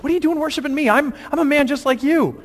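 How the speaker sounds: high-pitched, flustered disbelief; acted flustered objection at being worshiped; shocked protest. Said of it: "He's kinda shocked by this".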